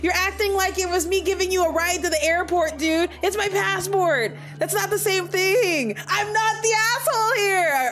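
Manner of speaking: exasperated arguing. Real, not acted. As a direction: insist it's your passport, fed up.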